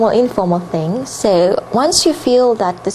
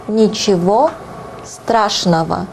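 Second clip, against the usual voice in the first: slow Russian; slower than usual. Shown as a demonstration. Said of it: "slowed repeat of phrase".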